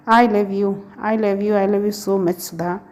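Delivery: ordinary delivery, nothing marked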